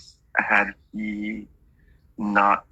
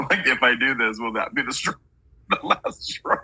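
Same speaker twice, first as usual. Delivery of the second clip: giggling over the words, slowing at the end; amused, half-laughed question; laughing through it; 4 semitones higher than usual. Real, not acted. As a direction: let the laugh break into the quote. Laughing while quoting the spirit.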